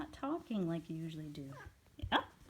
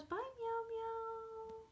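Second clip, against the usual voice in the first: very high, slow, stretched farewell to the cat; singsong goodbye; slower than usual. Real, not acted.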